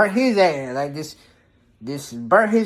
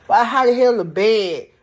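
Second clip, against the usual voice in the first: normal talking voice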